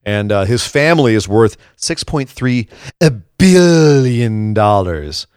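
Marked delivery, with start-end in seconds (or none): 3.4-5.3 BILLION stretched out very slowly; hammed-up, long-drawn billion for the huge sum; drawn-out emphasis.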